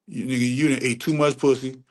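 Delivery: ordinary delivery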